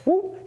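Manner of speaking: sharp high whoop on the shift; whoop sound effect. Shown as a demonstration. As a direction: vocalize the slip. Coach vocalizing the shift.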